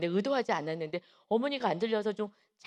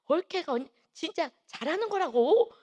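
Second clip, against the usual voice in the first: imitated raised voice; 6 semitones higher, 4 dB louder than usual. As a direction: imitate speaking up for a deaf mother. 귀 어두운 엄마에게 크게 말함. (귀 어두운 엄마에게 높고 크게 말하는 흉내)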